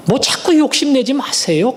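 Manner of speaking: ordinary delivery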